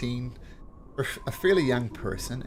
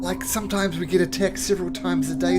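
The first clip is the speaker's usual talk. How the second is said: higher, strained frustrated voice; weary soldier; 8 semitones higher than usual. Acted. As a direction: vent the exhaustion, stammering at first. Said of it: "He says".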